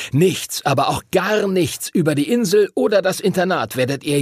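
strenger Ausbilder, nachdrücklich mit angehobener Stimme; strenger Master Duncan. A narrator's reading. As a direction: die Regel den Neulingen einschärfen. (strenger Ausbilder, eindringlich)

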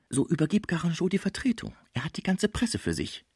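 high, light dancer's voice; dancer, coaxing. Acted. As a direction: press your case as the dancer, high. (drängende Tänzerin, hohe Stimme)

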